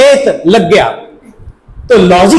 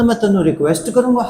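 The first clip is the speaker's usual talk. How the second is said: mock Punjabi teacher; 3 dB quieter, faster than usual. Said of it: "कहना".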